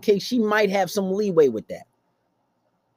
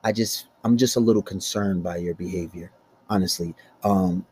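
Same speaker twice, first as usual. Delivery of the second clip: nice way; 5 semitones lower than usual. Shown as a demonstration. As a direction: explain it calmly and politely. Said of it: "you can do it in a nice way".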